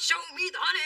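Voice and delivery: imitated bee shout. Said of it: "the little bee flies out of the box and shouts".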